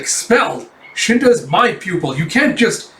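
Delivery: incredulous objection; indignant protest. Retold or imitated. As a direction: imitate an outraged teacher. Teacher protests pupil's expulsion.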